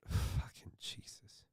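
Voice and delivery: breathy, disbelieving whisper; whispered curse. Real, not acted. Reacting to the streamer's views, a whispered curse. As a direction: curse under your breath.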